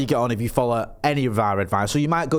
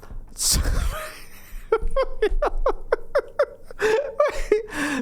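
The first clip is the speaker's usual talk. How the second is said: long, high-pitched burst of laughter. Real, not acted. Co-host reacts to frank sex advice, high laughter. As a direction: crack up helplessly at length.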